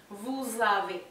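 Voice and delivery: "Vous avez" as vuz-ave; liaison z. Shown as a demonstration. Teacher drilling: vuz ave, linked. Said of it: "pasa a pronunciarse como una Z".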